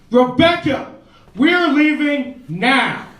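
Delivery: put-on drunk frat guy. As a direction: drag it out like a drunk bro. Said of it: "drunk frat guy ... just goes".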